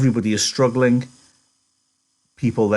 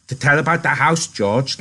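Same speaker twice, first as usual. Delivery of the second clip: higher, pleading request; begging; 5 semitones higher, 4 dB louder than usual. A narrator's reading. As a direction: plead eagerly to be told the story. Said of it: "Lenny begged".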